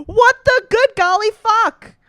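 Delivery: full-voiced outraged confusion; exasperated shriek. Real, not acted. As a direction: shriek at the absurd question. Baffled by odd quiz question.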